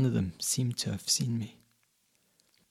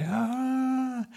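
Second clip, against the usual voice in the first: long, high scream of fear; high frightened cry; 3 dB louder than usual. A narrator's reading. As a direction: cry out as the tree swings. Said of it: "I called out, cried".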